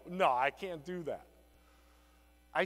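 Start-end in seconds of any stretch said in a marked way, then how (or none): 0.1-1.2 mimicking the client. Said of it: "Here's the close".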